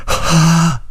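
a high, piercing woman's scream; shrill scream. A narrator's reading. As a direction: scream out in terror, pitched high. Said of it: "一声凄厉的尖叫". (凄厉的女声尖叫)

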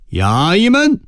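high, rising call to the guards; official's bellowed summons. A narrator's reading. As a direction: call out to summon your men. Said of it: "一听府尊大人吆喝". (拔高声调吆喝衙役)

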